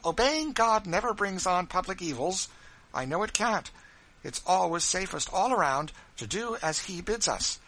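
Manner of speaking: arguing from scripture with fire; impassioned wife. Acted.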